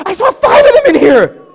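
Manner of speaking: put-on very high, loud childlike outburst; excited kid. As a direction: burst out with a thrilled discovery. Boy excited at valuable stamp.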